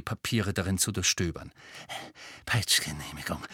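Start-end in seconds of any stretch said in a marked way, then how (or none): 2.4-3.4 low, soft, gloating to himself; feverish mutter. A narrator's reading. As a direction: savour the word under his breath. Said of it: "fiebrig mit sich selbst redend".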